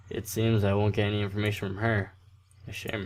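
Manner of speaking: normal talking voice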